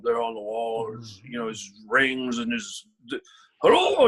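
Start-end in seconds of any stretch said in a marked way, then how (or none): 3.6-4.1 high, loud hello; mock portrait greeting. Portrait on the wall greeting the nervous actor, hammed-up and high.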